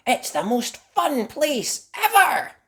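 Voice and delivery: very high, slow, thrilled little mouse; excited Mandy. Acted. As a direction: gush about it like a delighted child. Mandy brags about Cheese World.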